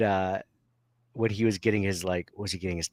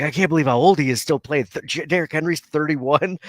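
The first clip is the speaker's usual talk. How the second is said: higher-pitched incredulous imitation; mock disbelief; 6 semitones higher, 4 dB louder than usual. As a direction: imitate someone amazed at a player's age. Mimicking amazed sports fans.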